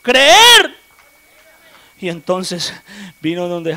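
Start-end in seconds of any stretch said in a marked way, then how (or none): 0.0-0.8 high, loud, slow preaching cry; preacher crying out to believe; crying shout. Real, not acted. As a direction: shout out the third point.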